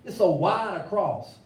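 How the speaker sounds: God restated in slang, lightly voiced; God in street voice. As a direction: talk it like a homie. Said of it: "he said".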